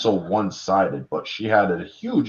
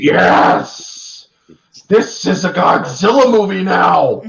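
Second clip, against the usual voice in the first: loud, high, thrilled cheer; fan's cheer; 9 semitones higher, 6 dB louder, slower than usual. Real, not acted. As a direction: celebrate like a fan in the theater. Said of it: "fan cheering in theater".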